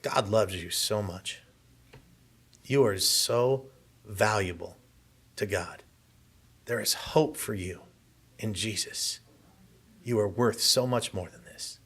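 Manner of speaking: gentle doctor. Retold or imitated. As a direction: softly reassure a broken patient. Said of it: "and he's like".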